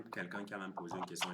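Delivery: ordinary delivery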